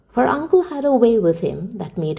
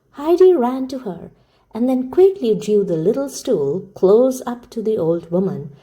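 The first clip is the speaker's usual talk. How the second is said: ordinary delivery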